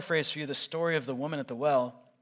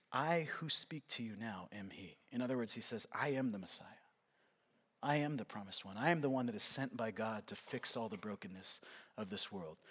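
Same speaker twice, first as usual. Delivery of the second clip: hushed solemn reveal; 7 semitones lower, 14 dB quieter than usual. Acted. Jesus tells the Samaritan woman he is the Messiah, softly and solemnly. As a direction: let the reveal land in a hush.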